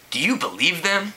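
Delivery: a light snarl at the news. A narrator's reading. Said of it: "Jessie snarled".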